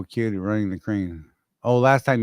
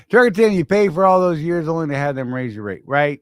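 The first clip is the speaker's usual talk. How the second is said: raised voice to a listener; calling out; 5 semitones higher, 6 dB louder than usual. Real, not acted. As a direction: call out loudly.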